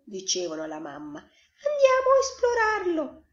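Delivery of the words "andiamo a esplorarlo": very high, loud duckling voice; eager ducklings. A narrator's reading. Ducklings urge mum to go exploring, eager. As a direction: pipe up like an eager hatchling.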